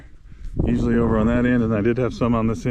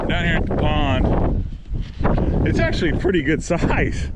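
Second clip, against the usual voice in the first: shouting over wind; 5 semitones higher, 5 dB louder than usual. Real, not acted. Loud talk in strong wind.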